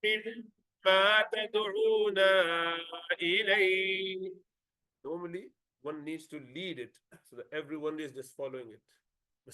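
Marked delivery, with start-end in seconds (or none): 0.0-4.3 slow chanted tajweed, drawn-out vowels; Quran recitation. Shown as a demonstration. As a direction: chant the verse slowly. Teacher recites verse slowly.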